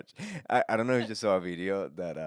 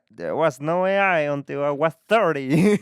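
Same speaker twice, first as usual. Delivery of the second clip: hammed-up old-timer; 7 semitones higher, 11 dB louder than usual. Elder telling kids about life before AI, hammed-up old-timer. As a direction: boom it out like an indignant elder.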